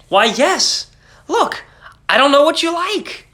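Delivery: high, gracious offer; generous friend. A narrator's reading. Friend voiced in story.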